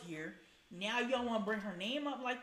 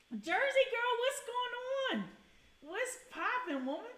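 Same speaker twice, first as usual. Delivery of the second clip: excited high greeting; 13 semitones higher, 4 dB louder than usual. Real, not acted. Streamer greeting a viewer in chat, high excited call. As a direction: call out to a friend joining.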